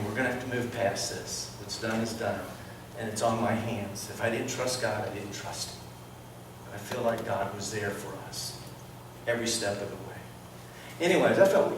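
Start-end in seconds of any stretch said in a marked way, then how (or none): 0.1-10.2 low, soft, subdued; quiet, sincere reassurance. Retold or imitated. Husband soothes his upset wife, low and soft. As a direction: gently settle it, voice dropped.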